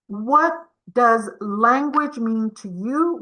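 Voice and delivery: ordinary talk, nothing marked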